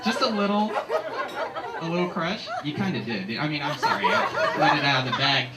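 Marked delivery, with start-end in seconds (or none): 0.0-2.5 embarrassed laughing. Real, not acted. Caught out about a crush.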